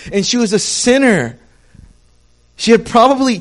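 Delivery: loud, high impassioned preaching; preaching at full force. Acted. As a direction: lift the room, full fervent sermon voice. Preaching crescendo.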